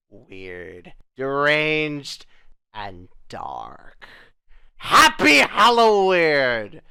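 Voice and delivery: slow, loud horror-host outro; spooky announcer sign-off. Acted. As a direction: drag out each word like a creepy host.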